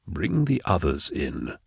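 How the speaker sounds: hushed, slow urging; low and slow, keep it secret; a slow hushed order to Ide; whispered. A narrator's reading. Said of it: "Cyrus whispered to him".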